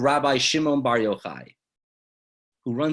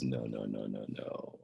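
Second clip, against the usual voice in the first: gentle reassurance; 13 semitones lower, 12 dB quieter, slower than usual. Acted. Rabbi Shimon comforts son-in-law.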